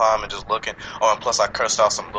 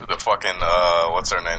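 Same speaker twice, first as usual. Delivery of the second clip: usual speaking voice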